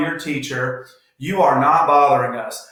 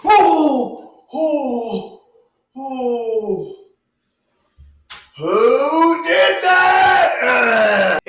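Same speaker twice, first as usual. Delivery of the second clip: startled; 9 semitones higher, 5 dB louder, much slower than usual. Acted. Dad gets splashed by the nozzle.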